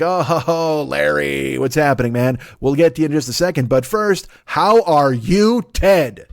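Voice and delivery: hearty greeting, swelling to the end; mock jovial welcome. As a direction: greet Ted like a guest of honour, drawn out. Heaven's gatekeepers greet the arrivals.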